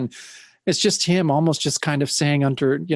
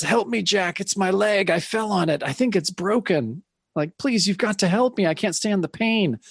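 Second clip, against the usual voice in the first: pained pleading; 6 semitones higher than usual. Retold or imitated. Hurt friend pleading.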